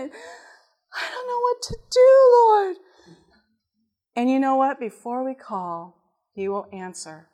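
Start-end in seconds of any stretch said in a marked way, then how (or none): 0.0-2.8 high, drawn-out exaggerated wail; mock crying. Wife retells crying to the Lord, mock wailing. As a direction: wail it high and slow as a hammed-up plea. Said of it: "I cried and I cried".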